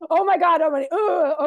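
loud, high burst of retold panic; panicked outburst. Recovering addict reliving her panic to the group, loud and high. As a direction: blurt it out like a sudden panic attack.

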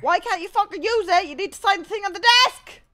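high, loud angry protest; frustrated rant at full voice; exasperated shouting. Real, not acted. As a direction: yell at the game for refusing. Arguing with game's refusal.

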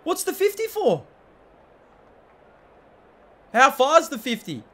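indignant outcry. Real, not acted. Outraged at fifty metre penalty.

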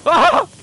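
loud, high-pitched "ah"; startled cry. Real, not acted. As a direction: cry out sharply in alarm. Crying out during gameplay.

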